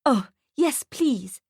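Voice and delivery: mildly gushing mother; lightly voiced delighted yes. A narrator's reading. Mother accepts the photo offer, a touch gushing. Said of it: "she gushes".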